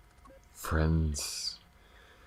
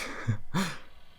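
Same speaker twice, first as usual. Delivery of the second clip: high giggle of relief; happy laugh; 10 semitones higher than usual. Acted. Giddy laugh after partner chooses to stay. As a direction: laugh with joy.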